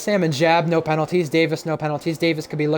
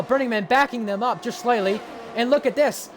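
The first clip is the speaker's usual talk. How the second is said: higher, excited race call; motorsport commentator; 8 semitones higher than usual. Real, not acted. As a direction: call the battle live, building energy. Live race play-by-play.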